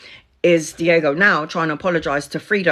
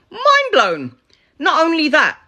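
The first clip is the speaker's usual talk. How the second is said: Mind blown shouted much higher and louder; excited; 9 semitones higher, 4 dB louder than usual. Shown as a demonstration. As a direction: burst out Mind blown in amazement. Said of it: "repeats for effect".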